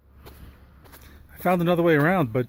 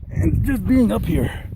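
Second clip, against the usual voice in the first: awed; 7 semitones higher, slower than usual. Real, not acted. Awed by the view.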